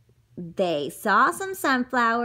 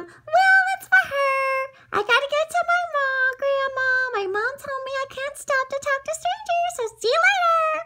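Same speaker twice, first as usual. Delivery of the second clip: put-on squeaky girl rushing her words; high and fast, eager to get away; high hurried girl voice. Little girl brushing off the wolf, high and quick.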